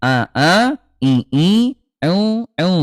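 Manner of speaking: "a, i, u" pressed from the mid throat; ain vowels. Shown as a demonstration. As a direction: squeeze "a, i, u" as ain. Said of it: "kalau ain".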